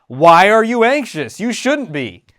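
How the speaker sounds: put-on loud impatient parent dismissing child's fear; scolding parent. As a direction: scold the kid for feeling anxious. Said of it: "like a parent talking to a little kid".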